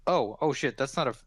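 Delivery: usual speaking voice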